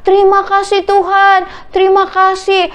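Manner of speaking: high-pitched cry of thanks; shouting praise. A narrator's reading. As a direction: call out gratefully to the Lord. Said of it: "Bartimius teriak, memuji Tuhan".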